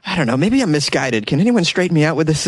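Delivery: ordinary talk, nothing marked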